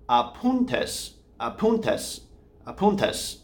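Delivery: "apuntes" with the stress on "pun", middle syllable lifted. Shown as a demonstration. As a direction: hit "pun" in "apuntes" harder. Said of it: "the emphasis, the syllable".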